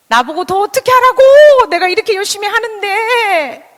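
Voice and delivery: high, loud, drawn-out wail of protest; exasperated outburst. Acted. Overworked parent protesting inwardly, exasperated high shout. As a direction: cry out at the unfairness, stretching the endings.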